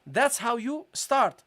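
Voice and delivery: ordinary talk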